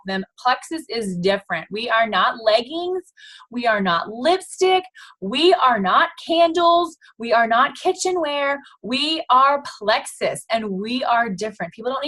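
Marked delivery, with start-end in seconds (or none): 1.7-10.4 each line slowed and punched, rising to a peak on candles; proud brand mantra, slow and emphatic; slow emphatic list. Shown as a demonstration. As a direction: spell out each item slow and firm.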